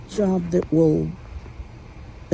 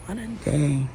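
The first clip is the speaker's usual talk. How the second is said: ordinary talk, nothing marked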